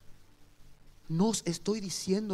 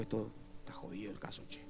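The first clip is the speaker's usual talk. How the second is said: susurro; 8 semitones lower, 11 dB quieter than usual. Retold or imitated. El evangelista habla para sí.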